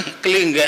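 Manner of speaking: क्लिंग with a clear nasal ng ending; correct klinng. Shown as a demonstration. Guru correcting the disciples: क्लिंग said as kling. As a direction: say क्लिंग as kling, not klem. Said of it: "उसको बोलते हैं क्लिंग".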